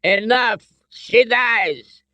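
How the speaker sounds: high, menacing cry; commanding threat. A narrator's reading. Colonel with gun on Elsa, threatening cry. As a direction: cut them off with a threat.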